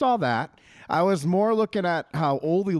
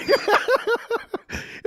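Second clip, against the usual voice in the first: high laugh. Real, not acted. Laughing at own story.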